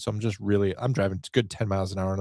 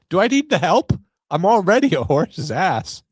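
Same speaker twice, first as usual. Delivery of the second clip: high, loud rhetorical protest at fate; exasperated self-mockery; 8 semitones higher, 5 dB louder than usual. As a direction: protest loudly against your own rotten luck. Mock lament to fate.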